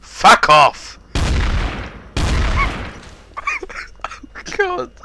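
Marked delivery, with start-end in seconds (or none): 1.1-3.1 high squeaky laugh. Real, not acted. Laughs after telling off.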